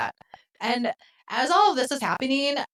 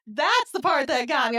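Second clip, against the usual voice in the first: usual speaking voice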